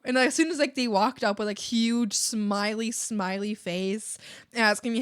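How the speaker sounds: ordinary talk, nothing marked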